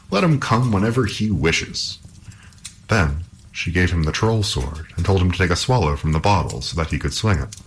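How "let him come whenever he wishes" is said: lightly voiced hero, pitched higher; unafraid Halvor. A narrator's reading. Halvor answers the princess's pleas, mildly defiant. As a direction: shrug off the danger with a raised voice. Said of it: "but Halvor said".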